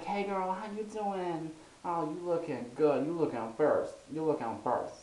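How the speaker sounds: mock the sassy "hey girl" greeting, drawn out and high. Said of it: "born with a voice like that".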